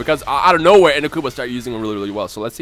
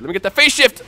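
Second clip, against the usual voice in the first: higher and louder, straining for the move; excited urgent call; 7 semitones higher, 7 dB louder than usual. Real, not acted. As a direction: push it out as the move hits. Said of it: "repeats the line, second louder".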